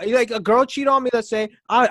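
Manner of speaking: normal talking voice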